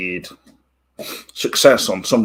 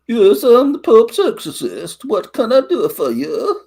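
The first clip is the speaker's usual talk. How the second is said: mock Italian accent; 13 semitones higher, 5 dB louder than usual. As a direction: imitate Crowe's silly movie accent. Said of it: "he comes in and goes something like".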